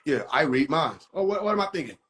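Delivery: ordinary delivery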